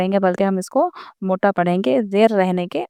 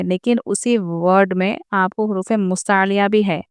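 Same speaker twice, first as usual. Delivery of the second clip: ordinary talk, nothing marked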